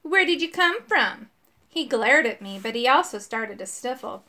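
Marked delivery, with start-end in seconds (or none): 0.0-1.3 mildly mean, higher-pitched question; pushy, raised-pitch demand; trying to sound mean. A narrator's reading. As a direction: fire the question with a put-on mean edge. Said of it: "trying to sound mean".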